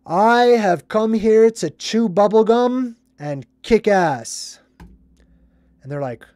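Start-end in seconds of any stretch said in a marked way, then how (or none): 0.1-4.6 slow, loud, raised declaration; movie-hero proclamation. Retold or imitated. Armed hero addressing the bank crowd, bold slow proclamation. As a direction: announce it grandly, stretching the words. Performing the hero's famous movie line.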